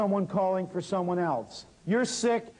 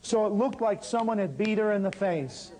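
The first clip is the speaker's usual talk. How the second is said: normal talking voice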